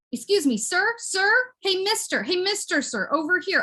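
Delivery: high, insistent hailing; high calling out. Pretend. Hailing the mister who ignores them, high calling out. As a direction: call to catch his attention, pitched well up.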